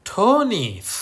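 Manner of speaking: teacher shows the possessive ending; emphatic possessive. Shown as a demonstration. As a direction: hit the added s of "Tony's". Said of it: "ยกตัวอย่างเช่น".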